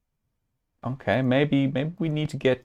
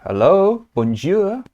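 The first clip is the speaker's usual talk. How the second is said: put-on high, loud French-accented phone greeting; badly faked French lilt, rising at the end; attempted French accent; 8 semitones higher, 5 dB louder, slower than usual. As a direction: answer brightly in a shaky attempt at French. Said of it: "I cannot do a French accent".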